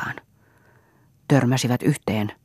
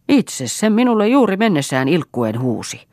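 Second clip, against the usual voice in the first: higher, louder retort; indignant; 7 semitones higher, 4 dB louder than usual. Acted. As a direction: snap back at the son. Mother answers son angrily. (kiivas vastaus)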